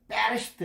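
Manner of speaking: 베레시트 with a tense, forceful opening B, like 뻬; 베레시트 begun on a doubled, unaspirated 뻬; hard first consonant. Shown as a demonstration. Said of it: "이렇게 읽어야 됩니다".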